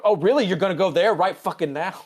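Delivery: high, loud incredulous comeback; mock indignation. Spock retorts to talk of his marriage, mock indignant. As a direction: act out an affronted retort.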